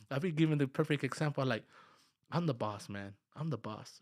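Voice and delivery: ordinary talk, nothing marked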